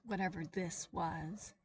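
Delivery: ordinary delivery, nothing marked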